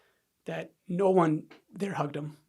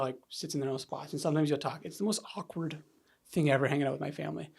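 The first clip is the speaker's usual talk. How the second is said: ordinary talk, nothing marked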